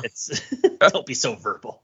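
half-laughing, teasing quote; a light laugh breaking into the words; laughing through a mock jab. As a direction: chuckle while mimicking the insult. Joking imitation of the insult.

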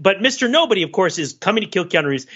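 normal talking voice

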